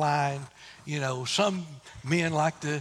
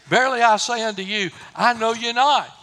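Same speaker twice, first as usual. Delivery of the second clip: bridegroom's stern reply; 5 semitones higher, 9 dB louder than usual. Retold or imitated. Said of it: "And the bridegroom said".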